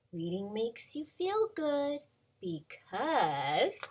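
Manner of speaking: slow, bright storybook read-aloud; reading to children. Acted. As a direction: read it like a picture book to little kids. Reading picture book aloud.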